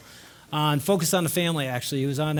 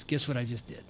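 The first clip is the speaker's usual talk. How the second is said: low, soft teasing question; understated acted-out nephew's reveal; quiet suspense; 6 semitones lower, 10 dB quieter than usual. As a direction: hold back big news in a hushed voice. Nephew teasing his big news.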